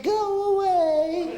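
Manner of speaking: slow spooky ghost whine. Acted. Ghost punchline.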